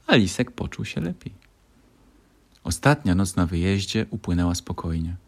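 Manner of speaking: ordinary delivery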